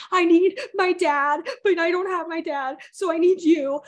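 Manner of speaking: high, loud tearful wail, slowed; mock wail. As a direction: wail it like a crying kid. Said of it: "But I was like".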